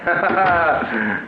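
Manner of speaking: a pleased, gloating chuckle; smug triumphant laugh. Acted. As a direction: laugh at her question like a winner. Roger shows off blackmail money.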